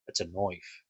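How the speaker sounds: lightly attempted Aussie accent, "noif"; half-hearted Australian movie quote. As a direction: try a Crocodile Dundee Aussie line. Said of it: "Stupid accent that I'm trying".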